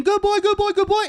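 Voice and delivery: very high, gushing praise voice; excited dog praise. Retold or imitated. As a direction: cheer on the dog in a squeaky voice. Praising dog on bringing back bird.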